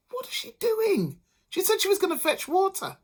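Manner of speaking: high crying question; puzzled husband. A narrator's reading.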